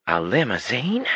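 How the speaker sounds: put-on a girl dazzled by a limousine; gasping surprise. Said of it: "Janny gasped and nearly swooned".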